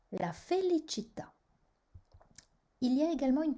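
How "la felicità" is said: la felicità with the accent on the final TÀ; stress on last syllable. Shown as a demonstration. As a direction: land on TÀ in la felicità. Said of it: "sur la dernière syllabe". (accent tonique sur « tà » dans la felicità)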